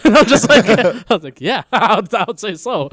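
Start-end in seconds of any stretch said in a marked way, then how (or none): 1.4-2.9 high, loud, through laughter; laughing mock reply. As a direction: crack up while play-acting the answer. Laughing mock reply to film line.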